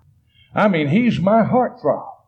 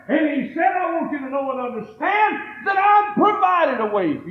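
full-force preaching; 7 semitones higher than usual. Acted. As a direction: hammer the message home to the congregation. Preacher builds to a shout.

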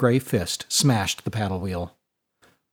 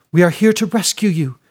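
eager offer; 6 semitones higher, 5 dB louder than usual. A narrator's reading. Fiona to prisoner.

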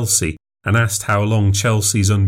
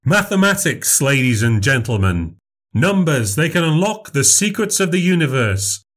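higher, bright showman voice performing for a crowd; showman memory act; 6 semitones higher than usual. Acted. Memorizer grand lecture.

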